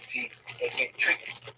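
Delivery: usual speaking voice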